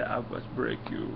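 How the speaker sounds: low, soft gruff-hero impression; a hint of movie-boxer menace, kept quiet; Sylvester Stallone. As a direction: lightly imitate a heavy movie tough guy. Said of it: "Sylvester Stallone to play Drax".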